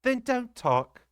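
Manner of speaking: high, slow, tense retort; curt nurse. A narrator's reading. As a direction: snap back while fleeing. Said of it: "said Ludi".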